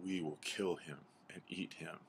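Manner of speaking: understated sly menace; sly wolves. Acted. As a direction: quietly offer to kill, lightly in character. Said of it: "step forward very slyly".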